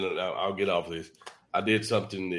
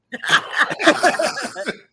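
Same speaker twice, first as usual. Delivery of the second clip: high, loud laugh bursting out; burst of laughter; 7 dB louder than usual. Real, not acted. Laughing after telling the hosts his dad's warning, loud and high.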